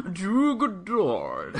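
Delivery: very slow, high "Drew Goddard"; hammed-up, long-held "Drew Goddard"; comic drawn-out name. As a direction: stretch each vowel of "Drew Goddard" while amused. Stretching the name for laughs.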